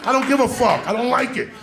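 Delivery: high, loud bratty tantrum; stubborn boy yelling at his food; loud childish rant. Retold or imitated. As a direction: rant like a kid who hates his vegetables. Kid ranting against broccoli.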